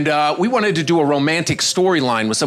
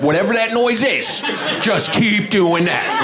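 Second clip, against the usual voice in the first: higher, then dragged-out slow urging; urgent plea; 5 semitones higher, slower than usual. Retold or imitated. Nervous crew begging him to keep it up, urgent. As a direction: plead with him, stretching the last words.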